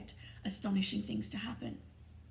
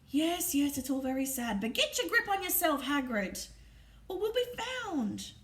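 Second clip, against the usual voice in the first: ordinary delivery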